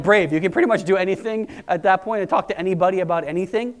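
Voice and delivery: ordinary talk, nothing marked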